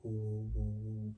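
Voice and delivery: low, soft droning hum; imitation of a fan whirring; imitated fan hum. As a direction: hum quietly like a running fan. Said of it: "The fan's going".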